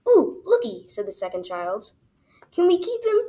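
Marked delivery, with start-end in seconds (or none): 0.1-0.9 high, loud little squeal of interest; excited child. A narrator's reading. Second child spots Little Tim Tim, excited. 2.5-3.2 put-on high, eager kid's question; pleading child. A narrator's reading. Child asks dad to keep the newcomer, eager.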